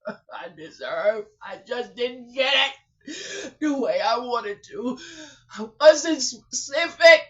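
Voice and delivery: loud tearful sobbing words; crying out. Real, not acted. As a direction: cry it out through tears. Emotional prayer, crying out.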